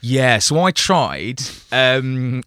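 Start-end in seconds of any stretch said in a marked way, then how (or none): 0.4-1.4 slow, leaning hard on "tried"; stress on tried. Shown as a demonstration. Telling the podcast host about the failed swim, stress on "tried". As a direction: draw out "tried" so it sounds like a failed attempt. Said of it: "emphasis on the word tried".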